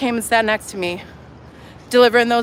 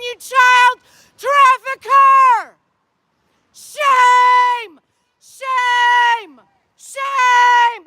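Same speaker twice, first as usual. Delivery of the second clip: shouting shame; 10 semitones higher, much slower than usual. Real, not acted. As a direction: yell it at her, drawn out. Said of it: "now she's gonna try to out yell".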